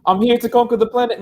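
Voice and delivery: high alien conqueror, Kiwi lilt; starfish voice. Retold or imitated. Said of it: "He's gonna be like".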